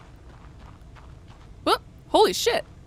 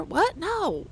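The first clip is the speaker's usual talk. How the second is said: ordinary talk, nothing marked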